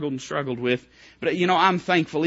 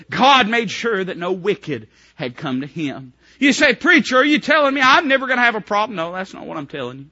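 higher, louder, building preacher's shout; full-force preaching; 8 semitones higher, 9 dB louder than usual. Acted. Preacher voicing a believer calling to family, building shout. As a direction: build it up, calling out each relative.

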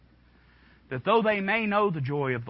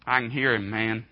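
ordinary delivery

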